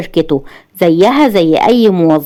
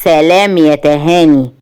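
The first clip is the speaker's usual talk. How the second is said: lower, smug and self-assured; confident; 4 semitones lower, slower than usual. A narrator's reading. Man taunting the woman he exposed, lower and confident. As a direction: gloat calmly from above. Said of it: "بثقة".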